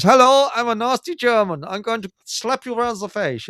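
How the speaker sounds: high, hammed-up villain German; nasty German accent. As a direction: sneer it like a TV Nazi. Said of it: "the Germans all spoke like this".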